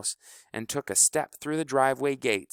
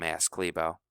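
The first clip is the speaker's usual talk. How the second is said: ordinary delivery, nothing marked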